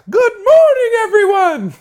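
very high, loud squealed greeting; hammed-up falsetto child, desperately cheerful; over-cheerful kid. Chastened boy greeting everyone after the bathroom, mock over-cheerful shriek. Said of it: "Trey was like".

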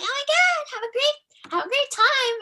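very high, bubbly mock cheer; giddy fan voice. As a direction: imitate giddy fans. Mocking cheerful fans.

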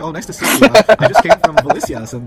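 high, loud mock-Korean accent, teasing. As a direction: tavern mocker imitating a Korean accent to taunt. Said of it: "imitating the Korean accent".

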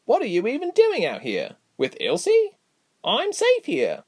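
very high-pitched little character voice; high, drawn-out bewildered replies; Gilly, bewildered at the reunion. Acted. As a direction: question her in a high, astonished voice.

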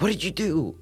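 high-pitched imitation of a blaming coworker; mock accusation. Coworker blaming him, acted out.

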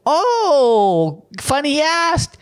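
high, slow, syrupy sweet answer; drawn-out saccharine agreement, mocking; mock cheery local. Local answering the pollster, high sugary mock voice.

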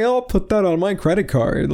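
lightly mocking posh rich-kid voice; a hint of a smug rich baby; fancy rich kid. Rich cartoon baby bragging to friends, lightly mocked. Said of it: "fancy schmancy, like, rich kid".

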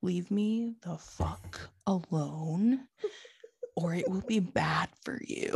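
hurt daughter quietly warning her dad off; quiet menace. Retold or imitated. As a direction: growl it quietly to be left alone. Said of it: "so angry".

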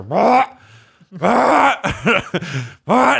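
high, raspy car-starting noise; short high bursts like a car failing to start; imitated ignition grind; imitated engine cranking noise. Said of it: "It sounds like someone trying to start a car".

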